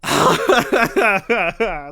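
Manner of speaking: loud burst of laughter; real, loud cackling laugh. Real, not acted. Breaks off emotional retelling.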